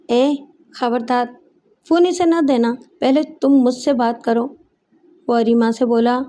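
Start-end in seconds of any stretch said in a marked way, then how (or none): none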